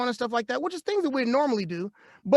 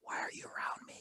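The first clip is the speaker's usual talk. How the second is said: mock whisper, much softer, incredulous; whispered retort; 20 dB quieter than usual. Replying to an unvaccinated friend, mock whisper. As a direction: hiss it back in mock alarm.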